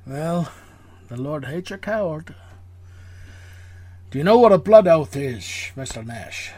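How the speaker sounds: a hint of Connery, dropping low to the end; Sean Connery impression. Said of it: "Sean Connery accent".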